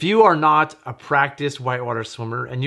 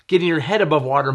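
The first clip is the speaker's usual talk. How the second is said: usual speaking voice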